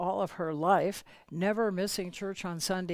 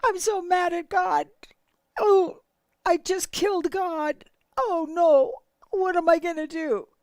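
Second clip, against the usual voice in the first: high, loud, wailing sob; crying; 10 semitones higher, 9 dB louder than usual. Retold or imitated. Grieving woman wailing to her husband about her friend, crying. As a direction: sob it out as grief breaks through. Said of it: "broke down in tears".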